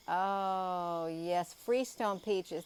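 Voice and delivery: oh yes, finally remembered; excited. Real, not acted. Recalls the forgotten name.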